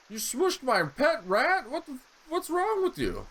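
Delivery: very high, slow, shocked outrage; outraged disbelief. Real, not acted. Player reacting to the sister killing his rat, shocked squeal.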